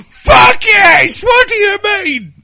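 very high, loud hammed-up squeal; excited shriek. Woman gushing to a friend about Phil, excited shriek. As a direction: shriek it at full pitch.

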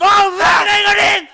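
very high, loud outcry; outraged shout. Real, not acted. Contestant yelling at the co-hosts in disbelief. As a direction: yell it in disbelief. Arguing over the answer.

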